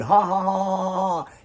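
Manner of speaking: loud, high mock laugh; mock laughter. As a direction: act out nonstop laughing, obviously fake. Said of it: "laugh for a whole year straight".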